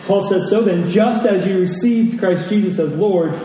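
ordinary talk

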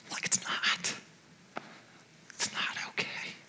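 barely voiced, fighting back tears; breath only, no voice, as the emotion catches; choked whisper. Real, not acted. Preacher reliving the call to his great aunt, whispering through tears.

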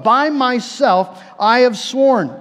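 very slow, weighty oath, each word drawn out; solemn oath. Acted. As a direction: lay down God's vow slowly and gravely. Repeating God's oath for emphasis.

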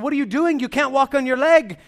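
high, loud, startled protest; retold surgeon's shocked outburst; alarmed surprise. Surgeon shocked to see patient walking.

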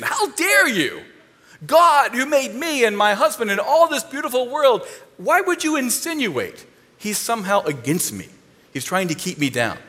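Eve scolding the snake for insulting God; indignant rebuke. Acted. Eve rebuking the serpent, outraged and indignant. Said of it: "She should have picked up a stick".

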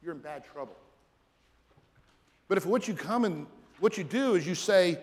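ordinary talk

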